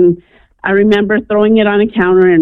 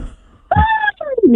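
a sharp, much higher, exasperated Fine; annoyed give-in. Retold or imitated. Woken teen answering the voice that ordered her to church, exasperated. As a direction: give in with a huff. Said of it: "mad 'cause I wanted to sleep".

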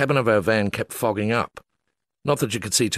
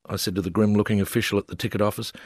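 ordinary delivery

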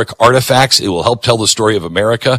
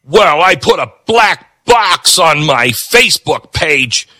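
higher, proud mocking voice; mock smug activist; 7 semitones higher than usual. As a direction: puff it up like a self-satisfied slacktivist.

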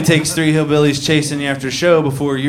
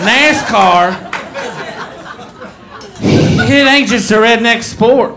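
put-on angry drunk woman; 8 semitones higher, 4 dB louder, slower than usual. Drunk heckler hollering at the comic, high and loud. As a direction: holler it like a furious drunk fan.

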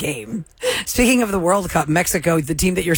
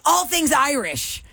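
imitating Mike Myers; 8 semitones higher, slower than usual. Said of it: "He's like".

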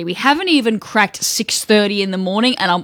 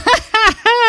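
high breaking laugh; cracking up helplessly; high burst of laughter; 14 semitones higher, 4 dB louder than usual. Real, not acted.

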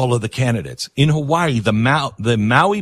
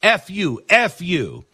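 mock scream; 5 semitones higher, slower than usual. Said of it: "Basin screams".

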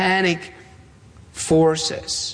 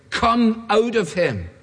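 slow, higher-pitched order; stern command; 6 semitones higher, slower than usual. Acted. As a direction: command it deliberately, with a touch of sternness. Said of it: "said Jesus sternly".

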